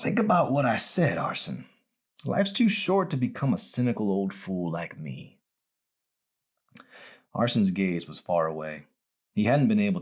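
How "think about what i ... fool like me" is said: lightly voiced old man, wistful; a hint of a worn old patient looking back; mild old-man voice, rueful; old dying man. Abe voiced.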